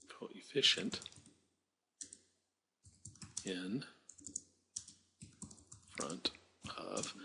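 a breathy, voiceless murmur of coefficient; whispered. Real, not acted. Teacher checking the spelling to herself, whispered. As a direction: whisper the word coefficient to yourself. Repeats word softly to self.

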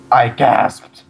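punchy mock-dramatic reveal; hammed-up cliffhanger. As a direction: overact the chapter's last words.

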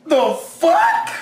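high-pitched, stunned protest; outraged disbelief. Real, not acted. Reacting to an attacker's release on bail, outraged disbelief. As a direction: echo the news in sheer disbelief.

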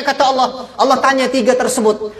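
ordinary talk

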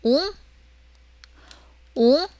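웅 gliding upward; soft rising 웅, like a question; second tone. Shown as a demonstration. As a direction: say 웅 in Mandarin second tone. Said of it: "웅에서 이승 올라가야죠".

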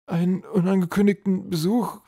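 high, loud questioning troll; frightened echo. A narrator's reading. Troll repeats the general's plan back, frightened. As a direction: echo it in alarmed disbelief. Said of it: "Er begann zu zittern".

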